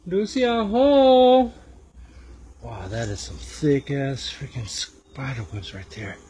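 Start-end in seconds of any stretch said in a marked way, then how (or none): 0.1-1.5 loud, high sing-out with a long-held last word; playful drawn-out shout; calling out. Pretend. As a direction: holler into the empty house. Calling into an empty house.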